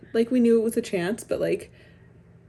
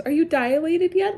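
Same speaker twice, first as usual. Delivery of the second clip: high-pitched mimicry of a prying texter; exaggerated prying imitation; mock nosy question; 7 semitones higher, 4 dB louder than usual. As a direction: parrot the intrusive question up high.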